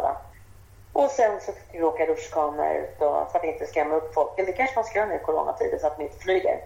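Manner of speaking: ordinary talk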